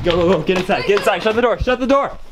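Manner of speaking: high, rising frantic commands; panicked urging. Acted. Student fleeing danger with friends, panicked urging. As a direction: hurry them inside, pitch climbing.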